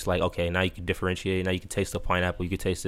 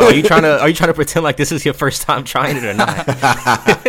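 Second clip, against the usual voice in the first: laughing disbelief; 7 semitones higher, 10 dB louder than usual. Real, not acted. Teasing co-host, laughing disbelief.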